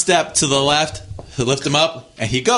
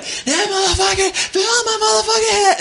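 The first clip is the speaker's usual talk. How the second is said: South Side attitude. Retold or imitated. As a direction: mimic a tough South Side girl going off. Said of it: "doing her, the South Side thing".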